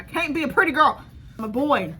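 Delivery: dramatic loud self-mocking rant; hammed-up meltdown. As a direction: overdo the despair for laughs. Melodramatic whining wig meltdown.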